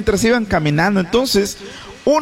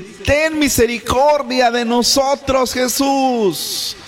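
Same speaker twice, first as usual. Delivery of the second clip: high raised plea for mercy; pleading cry; 6 semitones higher, slower than usual. Acted. Lepers begging Jesus for mercy, raised pleading voice. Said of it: "le gritaron diciendo".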